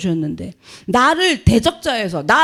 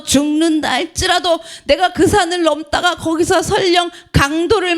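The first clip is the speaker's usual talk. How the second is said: fervent preaching; 4 semitones higher than usual. Acted. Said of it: "preaching Paul's resolve".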